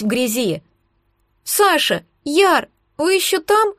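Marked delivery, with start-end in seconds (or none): 1.4-3.7 put-on high-pitched anxious child; small scared voice calling up; thin frightened little voice. A narrator's reading. Child checks friends are still there, high frightened voice. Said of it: "раздался снизу тонкий испуганный голосок".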